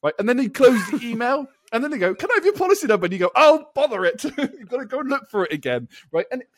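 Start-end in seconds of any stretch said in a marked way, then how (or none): 2.1-3.1 mock agent. Agent voice, pitched up. 3.3-4.2 mock annoyance. Retold annoyed reaction.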